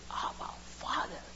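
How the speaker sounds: soft, intimate address to the Father; hushed prayer. Retold or imitated. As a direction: quote it in a near-whisper. Quoting Jesus' intimate cry.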